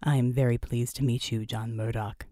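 very low machine-like speaker; synthesized voice. Acted. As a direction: speak as a courteous synthesizer, pitched right down. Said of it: "said the synthesized voice".

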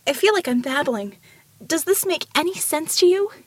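high-pitched, searching question; anxious babbling. A narrator's reading. Ramona asking the giant if she makes sense, anxiously. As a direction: plead to be understood.

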